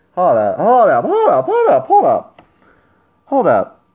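high, loud rapid "hold up"s; you can't believe what you just read; excited disbelief. Real, not acted. Reacting to a notification.

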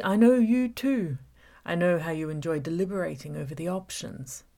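menacing villain toying with Gabby; villain Sean taunting. Acted. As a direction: savour every word of the trap. Villain Sean's taunting speech.

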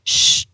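ch as a drawn-out "shhh"; ch with no vowel, like shushing; Albanian SH sound. Shown as a demonstration. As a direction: hold ch as a hushing noise. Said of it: "ça fait".

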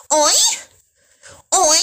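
a short high "Oi" moan; mock moan. Imitating a porn actress's moan for her viewers. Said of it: "the Brazilian bitch".

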